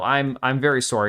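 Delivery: normal talking voice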